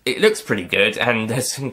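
usual speaking voice

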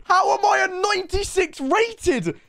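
high, exasperated yelling; furious, high-pitched rant; outraged shout. Real, not acted. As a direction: shout it out in disbelief at the rating. Outraged at his player rating.

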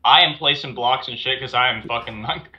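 usual speaking voice

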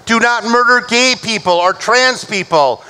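ordinary talk, nothing marked

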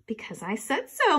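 plain reading voice

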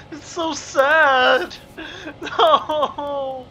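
slow, mock-sad words through laughter; laughing fake sadness. Real, not acted. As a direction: laugh while pretending to be heartbroken. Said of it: "I'm gonna cry now".

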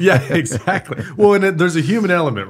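laughing while talking; bursting into laughter. Real, not acted. Laughing agreement after host's joke.

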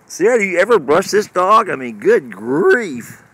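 complaining loudly about the unbrushed dog; exasperated call. Real, not acted.

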